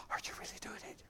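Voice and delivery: hushed gossip whisper; a retold churchgoer's whisper; whispered question. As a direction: breathe it like a secret rumour.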